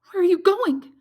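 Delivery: high, loud alarmed cry. A narrator's reading. Valentine clutches Maximilian's sleeve, crying out high and loud. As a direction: call him back in alarm. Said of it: "cried Valentine".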